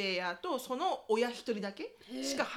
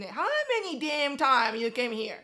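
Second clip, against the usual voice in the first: furious mom; 8 dB louder than usual. Retold or imitated. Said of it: "超キレてんの".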